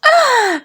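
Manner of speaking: sharp high gasp; shocked gasp. Acted. Mother gasps at son's money request, high gasp. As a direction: gasp in outrage.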